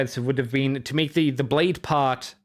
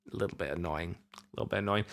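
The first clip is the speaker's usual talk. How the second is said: much softer, lower aside; grumbling aside; 9 semitones lower, 11 dB quieter, much faster than usual. Real, not acted. Reviewer grumbling to himself about payment trouble, quietly. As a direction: grumble it under your breath.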